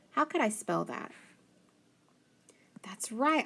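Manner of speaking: normal talking voice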